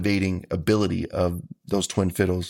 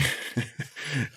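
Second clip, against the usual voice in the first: a short breathy laugh; soft laugh; 5 semitones higher, 9 dB quieter than usual. Real, not acted. Guest laughs at his own remark, soft laugh. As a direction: laugh it off lightly.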